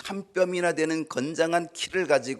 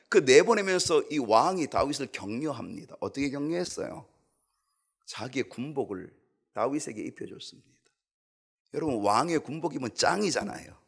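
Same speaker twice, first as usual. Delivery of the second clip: ordinary talk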